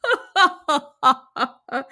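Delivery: a big hearty roar of laughter; lion's booming laugh. Acted. Lion laughing at the tiny mouse's offer, big and hearty. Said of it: "the lion burst into laughter".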